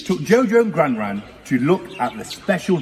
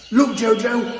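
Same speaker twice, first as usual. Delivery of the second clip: high eager call; excited cry; 6 semitones higher than usual. A narrator's reading. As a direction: point it out with excitement. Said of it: "cried Gran Gran".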